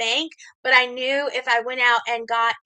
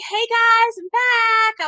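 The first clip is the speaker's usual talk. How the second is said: high, bright mock-cheery hello; cheerful greeting; 10 semitones higher, 6 dB louder, much slower than usual. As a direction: sing out a happy return. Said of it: "I was all cheerful and happy".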